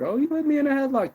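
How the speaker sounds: loud, high-pitched victim whine; hammed-up imitation of a tearful accuser; mock crying complaint. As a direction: play the wailing victim. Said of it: "If they cry their way out".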